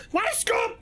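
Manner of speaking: high shouted transatlantic cry through a laugh; shouted scoop. Shown as a demonstration. Streamer overdoing the accent, laughing and shouting. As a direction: yell it like a newsie gone overboard. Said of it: "You're just shouting".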